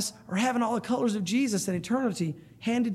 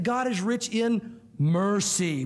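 mercy drawn out slowly, syllable by syllable; slow line with heavy emphasis on mercy; stress on mercy; much slower than usual. Shown as a demonstration. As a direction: lean hard on mercy, stretching it out. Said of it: "would be kind of a way to do that".

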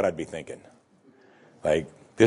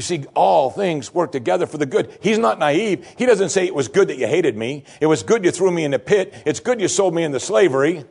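fervent preaching; 5 semitones higher, 3 dB louder than usual. Acted. Preaching at full force.

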